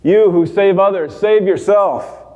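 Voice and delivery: mock him from the next cross, voice up high; taunting yell. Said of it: "He keeps yelling at Jesus".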